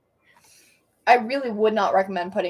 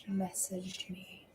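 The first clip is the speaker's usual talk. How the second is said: whispering; 18 dB quieter, much slower than usual. Real, not acted. Whispering to chat while streaming.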